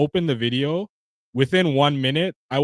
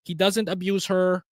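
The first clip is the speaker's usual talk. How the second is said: higher, put-on voice parroting the journalist; sending up her breezy defence of the dog; mocking Taylor Lorenz; 5 semitones higher than usual. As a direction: lift into a mock airy reporter voice. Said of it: "who came out and said".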